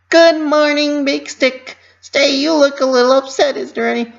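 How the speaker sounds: high, loud eager chirp; cheery greeting. A narrator's reading. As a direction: chirp brightly at a grumpy vendor. Pinkie greets grumpy vendor.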